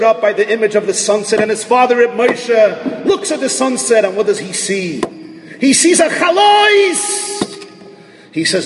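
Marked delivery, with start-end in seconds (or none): 5.6-7.4 high, slow, shouted revelation; preacher crying out the vision high; excited exclamation. Real, not acted. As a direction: burst out with the wonder. Climax of sunset story.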